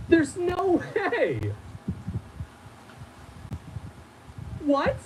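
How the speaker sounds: very high squeal of disbelief; shocked disbelief. Real, not acted. Reader cannot believe what the letter says, shocked squeal. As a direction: squeal it slowly, stretched right out.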